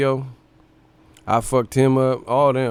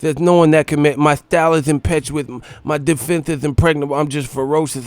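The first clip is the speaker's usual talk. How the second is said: boastful Tyson brag, higher and louder; Tyson impression; 3 semitones higher, 4 dB louder than usual. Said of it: "Mike Tyson was like".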